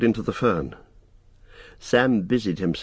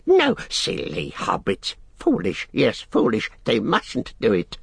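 high, agitated creature scolding; frightened, angry creature; 4 semitones higher than usual. A narrator's reading. Gollum scolds Sam for the fire, frightened and angry. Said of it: "seemed to be both frightened and angry".

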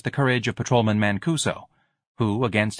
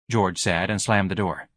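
usual speaking voice

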